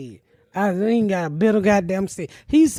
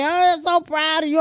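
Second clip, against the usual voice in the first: imitating crying brother; 7 semitones higher than usual. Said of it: "He just kept crying".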